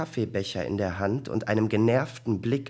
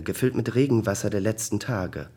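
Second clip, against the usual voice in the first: ordinary delivery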